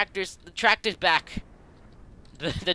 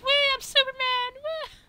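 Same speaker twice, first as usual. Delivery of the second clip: very high falsetto glee; squeaky flying car. Acted.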